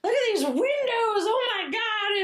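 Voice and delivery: wide-eyed delighted gushing; childlike excitement. Retold or imitated. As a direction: squeal it like a child in a new house. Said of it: "Very childlike".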